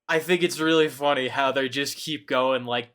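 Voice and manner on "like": ordinary delivery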